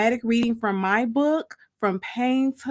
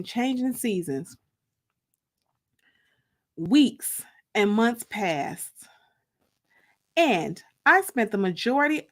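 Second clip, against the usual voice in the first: story narration. A narrator's reading. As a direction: read it like a dramatic reading for your listeners. Reads her book aloud.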